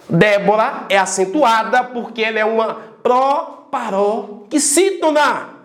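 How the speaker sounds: normal talking voice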